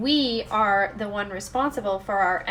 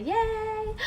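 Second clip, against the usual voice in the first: high-pitched little "yay"; playful cheer; 11 semitones higher, 4 dB quieter than usual. Real, not acted. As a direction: give a high, drawn-out cheer. Playful cheer opening the session.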